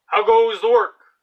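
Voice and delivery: high, loud call from the lazy farmer; bossy farmer calling. Acted. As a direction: bellow it like a lazy boss from bed.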